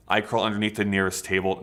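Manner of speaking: normal talking voice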